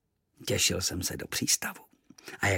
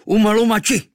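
much higher, louder cry; battle cry; 12 semitones higher, 10 dB louder than usual. Acted. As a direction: shout it as you loose the arrow. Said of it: "vykřikl".

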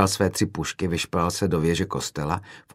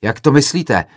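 louder, higher outraged question; agitated shout; 6 semitones higher, 7 dB louder than usual. A narrator's reading. Another student challenges the speaker, shouting agitatedly. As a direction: demand an explanation, voice raised. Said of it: "zařval rozčileně jiný student".

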